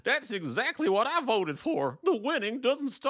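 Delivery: very high caricature voice, strained throughout; mock angry voter. Disillusioned Trump voter posting online, high mocking caricature. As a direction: squeal the complaint in a hammed-up character voice.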